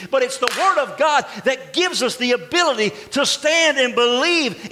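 preaching at full force. Acted. Pastor to his congregation, preaching at full force.

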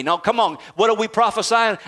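ordinary talk, nothing marked

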